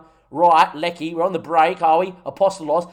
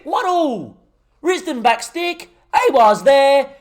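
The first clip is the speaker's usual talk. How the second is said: much higher, louder, excited shouting; goal celebration; 11 semitones higher, 6 dB louder than usual. Real, not acted. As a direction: roar it out after the equaliser. Celebrating the equaliser.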